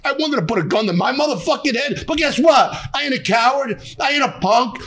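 shouting through real anguish. Real, not acted. Chef tells host about losing son, anguished shouting. Raw grief, defending not killing himself.

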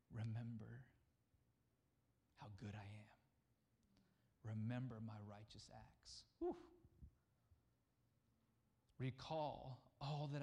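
low, soft and tender; gentle voice of God. Retold or imitated. God reminding his people, soft whisper. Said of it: "He says".

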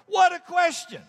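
high, incredulous cry; amazed exclamation. Real, not acted. Preacher marvelling at the question to the congregation, high and amazed. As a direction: marvel out loud at it.

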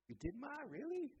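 high, amazed disbelief; surprised. Acted. Son stunned by his mother's admission, high and surprised. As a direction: jump up in pitch, astonished.

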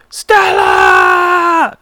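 loud strained whisper-shout; whisper yell. Shown as a demonstration. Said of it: "that was a whisper yell".

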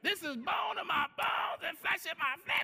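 rapturous proclamation. Acted. Adam's earlier rapturous words about Eve.

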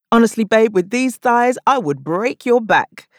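wink it at the skinny guy; flirty stage tease. Acted. Comic to skinny man in front row, cheeky flirty tease.

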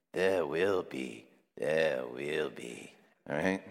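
slow, hushed repetition; low quiet warning. Real, not acted. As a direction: let it sink in gravely. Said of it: "repeated warning".

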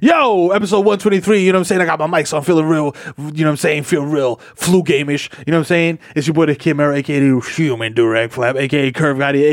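normal talking voice

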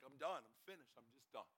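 low, soft resignation; quietly giving up; resigned surrender. Retold or imitated. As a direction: let it drop away, defeated. Retold giving up.

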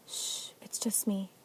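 put-on slow, hushed whisper to calm a child; soothing whisper. Ananiel calming the frightened little Kylan, soothing whisper. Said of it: "calmly rubs her back".

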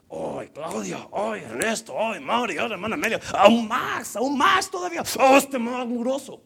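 building to a high, loud cry; mock exasperation. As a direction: wail each name, climbing to a shout. Mocks own exasperation at family members. (exasperación fingida que sube hasta el grito)

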